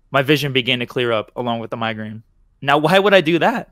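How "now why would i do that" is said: calmly. Acted. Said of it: "I asked calmly".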